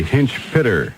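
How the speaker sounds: hinch pitter, pinch hitter with p and h swapped; spoonerism. Shown as a demonstration. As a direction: say hinch pitter trading first sounds. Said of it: "We were to spoonerize pinch hitter".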